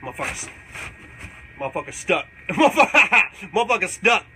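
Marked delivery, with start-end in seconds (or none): 2.5-4.2 laughing shout. Retold or imitated. Shouting at the lizard he hit with a dart. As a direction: belt it out with a laugh. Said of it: "I said".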